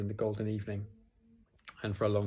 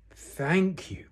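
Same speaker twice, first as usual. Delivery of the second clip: raised, loud bearish growl; growled thanks; 8 semitones higher, 5 dB louder than usual. A narrator's reading. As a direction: growl gratitude, a touch choked up. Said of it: "growled Pooh".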